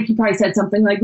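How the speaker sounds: usual speaking voice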